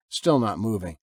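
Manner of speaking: ordinary delivery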